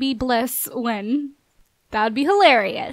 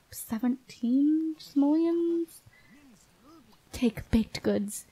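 breathy whisper, slow and hushed; whispered aside; 6 dB quieter, much slower than usual. Real, not acted. Player whispering to her game, a slow hushed whisper. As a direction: whisper it to yourself, slowly.

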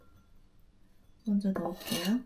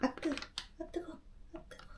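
yelping hot; 9 semitones higher, 10 dB quieter, faster than usual. Real, not acted. Burned by the hot pan.

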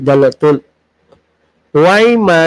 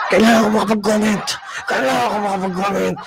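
mock basher; 2 semitones higher, 6 dB quieter, faster than usual. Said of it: "Ako, basher, halimbawa".

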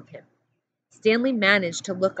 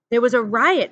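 urgent report; 4 semitones higher, 7 dB louder than usual. A narrator's reading. Pendanski reports to warden.

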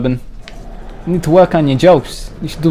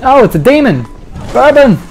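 higher, louder alarmed call; panicked yell; 8 semitones higher, 5 dB louder than usual. Real, not acted. Spotting a demon and calling Bourbon, panicked yell. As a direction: yell for help as it appears.

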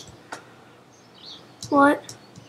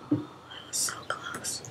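whispered, slow relief; hushed relieved whisper; 21 dB quieter, slower than usual. Real, not acted. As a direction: breathe it out in a whisper. Relief after near miss.

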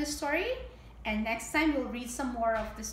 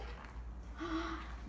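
gasp; 4 semitones higher, 8 dB quieter than usual. Acted. Story twist for children.

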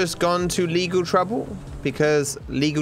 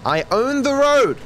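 boastful; 9 semitones higher, 4 dB louder than usual. Pretend. Boasting while honk boosting.

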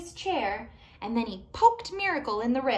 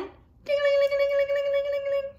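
high, loud ringing ding-ling chant; bell sound; 6 dB louder than usual. Shown as a demonstration. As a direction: ring it out like a little bell. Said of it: "Can you ring them again?".

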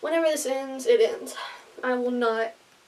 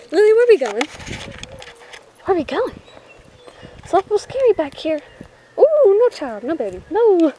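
scared dog voice; 6 semitones higher, 7 dB louder than usual. Pretend.

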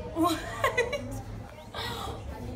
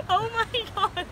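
amazed laughter; 13 semitones higher, 4 dB quieter than usual. Real, not acted. Spectator reacts to magic trick.

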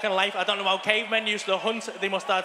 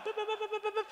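high, soft keypad beeps; quick mimicked beeping in a raised pitch; imitated alarm beeps; 13 semitones higher, 7 dB quieter than usual. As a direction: rattle off high electronic beeps.

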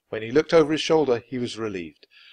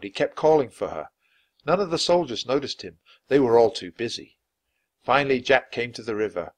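plain reading voice

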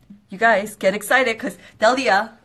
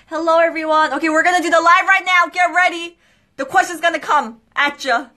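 higher, louder, fast hype; hyped host; 6 semitones higher, 5 dB louder, faster than usual. Real, not acted. Host hyping viewers before the live quiz. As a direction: pump up the audience quickly.

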